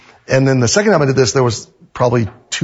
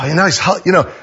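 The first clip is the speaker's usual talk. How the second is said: ordinary talk, nothing marked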